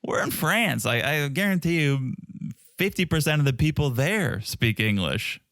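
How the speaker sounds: ordinary talk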